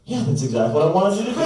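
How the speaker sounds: high, fast, laughing approval; Jimmy Hoffa cackling yes through a laugh; laughing Hoffa quote. Retold or imitated. Comedian voicing Hoffa's approval, high and laughing. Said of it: "Jimmy Hoffa was like".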